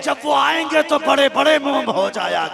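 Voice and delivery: loud, high, impassioned sermon voice; full-throated preacher's cry; fiery preaching. Acted. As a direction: preach it at full force to the crowd. Preaches the miracle at full force.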